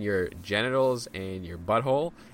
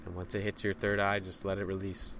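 raised urgent cues, fading to soft release; guided breathwork cues; 5 dB quieter than usual. Acted. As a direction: push through the hold, then let go softly. Cues hold, root lock, release.

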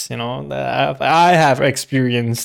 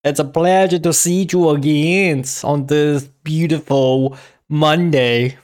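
normal talking voice

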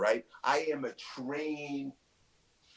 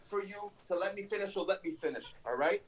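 angry; 6 semitones higher than usual. Acted. Heated promo threat at rival.

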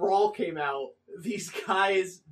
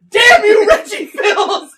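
much higher, loud cartoon yell; Sonic's shout; 13 semitones higher, 15 dB louder, slower than usual. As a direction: curse him as he runs off. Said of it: "And Sonic goes".

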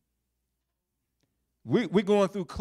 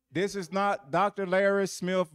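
ordinary delivery

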